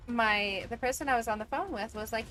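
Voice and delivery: ordinary delivery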